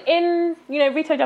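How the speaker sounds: usual speaking voice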